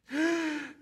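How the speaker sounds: sharp high gasp of fake fright; a hammed-up horrified gasp; mock gasp. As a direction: gasp as if the monster just appeared. Mock horror at monster slide.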